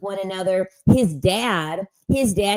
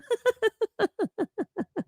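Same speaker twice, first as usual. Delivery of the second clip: breathy whispered laugh. Real, not acted. Laughing after gushing thanks.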